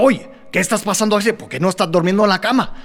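fast, scolding Cuban dad in Spanish; quick stern fatherly scolding. Retold or imitated. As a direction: rattle it off like a cross father. Angry dad scolding late child.

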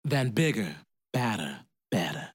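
plain reading voice

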